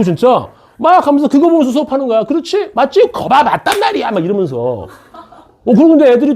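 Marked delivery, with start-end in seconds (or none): 2.2-4.1 mock eager teacher. Teaching to a room of dolls.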